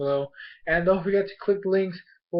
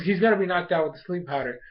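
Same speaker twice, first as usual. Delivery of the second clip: ordinary talk, nothing marked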